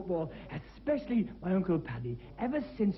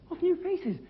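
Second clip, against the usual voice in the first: put-on girl at the match; 11 semitones higher, 4 dB louder, slower than usual. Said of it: "She said".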